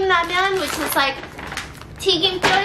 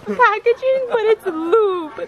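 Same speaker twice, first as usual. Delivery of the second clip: laugh-crying; 7 semitones higher, slower than usual. Real, not acted. Mock-whining about lube packaging.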